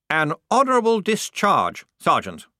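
high, loud officer savouring every word; relishing. A narrator's reading. Lieutenant presenting a sergeant's discharge, with creamy relish. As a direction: serve it up like a treat with a cherry on top. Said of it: "spoke the words as if they had cream and a little cherry on top".

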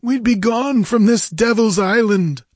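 high, loud pirate voice; hairy rogue's urgent high croak. A narrator's reading. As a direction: plead to get away, croaking. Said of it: "croaked a hairy rogue".

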